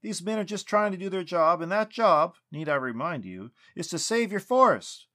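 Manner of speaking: loud, high frustrated shouting; angry yelling. A narrator's reading. Lucas yelling at the tree spirit, angry. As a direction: lose patience and shout. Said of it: "Lucas yelled".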